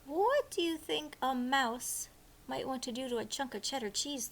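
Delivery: plain reading voice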